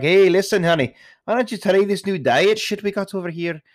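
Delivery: high-pitched, bad Scottish accent. Old customer bickering with a spouse, mock Scottish accent. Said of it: "talking in their Scottish accent".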